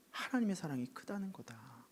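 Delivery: soft hushed whisper. A narrator's reading. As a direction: whisper it tenderly. Tender spiritual point. (속삭이듯 부드럽게)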